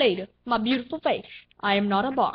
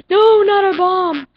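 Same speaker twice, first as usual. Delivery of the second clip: high, loud panicked protest; alarmed shout; 7 semitones higher, 8 dB louder than usual. Real, not acted. Gamer facing a bomb, alarmed shout. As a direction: cry out as danger lands.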